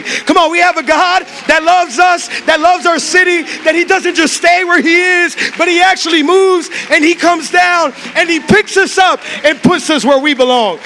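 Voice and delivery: loud, high, rousing preacher call; fiery sermon shout; preaching at full force. Acted.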